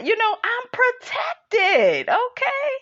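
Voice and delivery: high, slow, sing-song sass; sassy sing-song. Pretend. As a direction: float it up high like a diva teasing. Sassy self-protection aside.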